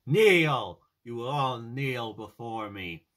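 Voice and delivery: loud commanding tyrant, slowing on the second line; Loki megalomaniac rant. Retold or imitated. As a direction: bellow like a self-declared emperor. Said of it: "Loki's trying to act like Hitler".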